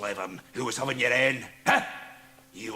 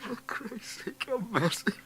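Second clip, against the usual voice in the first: pleading; 12 semitones higher than usual. Acted. Jekyll begs as he dies.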